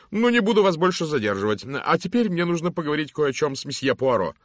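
loud, hearty lady's greeting; booming matron. Acted. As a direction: hail him like a bossy matron. Mrs Masterton dismisses Brewis. (громкий зычный голос дамы)

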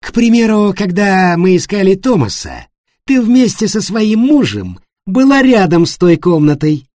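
loud, high, accusing challenge; challenging. A narrator's reading. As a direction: throw down the accusation. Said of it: "Он с вызовом посмотрел".

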